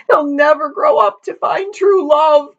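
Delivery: very high grieving wail; crying. A narrator's reading. Mother joins the family weeping, crying. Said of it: "cried the mother".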